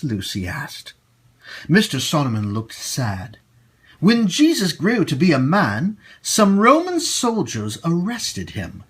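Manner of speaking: ordinary delivery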